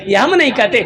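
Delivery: ordinary delivery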